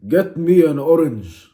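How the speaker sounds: loud, drawn-out command; mock demand. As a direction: slowly boom out the order, comically overdone. Acted demand in made-up story.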